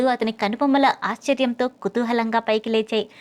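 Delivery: usual speaking voice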